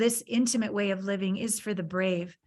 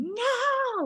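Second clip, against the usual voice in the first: high wailing no, half-voiced; retold scream. Teen running down the highway screaming no at the news.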